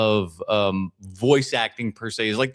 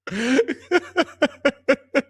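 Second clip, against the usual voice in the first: laugh; 13 semitones higher than usual. Real, not acted. Laughs after outburst.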